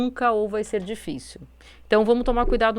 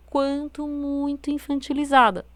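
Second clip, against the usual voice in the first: put-on higher, slow childish voice; infantilized intonation; 5 semitones higher than usual. Shown as a demonstration. Warning listeners against sounding childish, demonstrating an infantilized voice. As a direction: put on a babyish little voice, drawn out.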